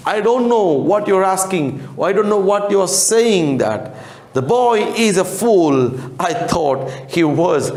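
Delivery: a hint of a baffled official; questioning gentleman. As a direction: gentleman talking about the boy. Teacher voicing Oliver's board questioners.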